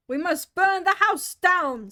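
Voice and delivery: the rabbit threatening to burn the house; rabbit's loud threat. Acted. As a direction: boom out the threat. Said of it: "said the rabbit's voice".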